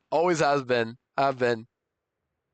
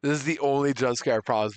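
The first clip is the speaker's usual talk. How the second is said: normal talking voice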